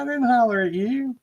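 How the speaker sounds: high-pitched mock protest; imitated denial. Teammate denying she called him, high protesting voice.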